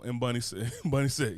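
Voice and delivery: ordinary delivery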